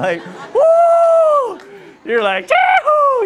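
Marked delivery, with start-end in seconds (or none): 0.5-1.6 loud high-pitched "woo" of delight; shrieked cheer over the food; excited whoop. Acted. Acted delight at the feast food. 2.5-3.3 loud, high, jubilant yell; hammed-up high shout of joy; whooping cheer. Acted delight at the feast food.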